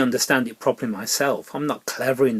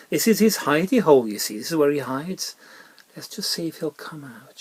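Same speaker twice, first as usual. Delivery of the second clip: usual speaking voice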